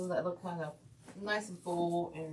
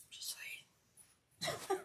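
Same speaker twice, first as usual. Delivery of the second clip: laughing whisper; 8 dB quieter than usual. Real, not acted. Laughing aside.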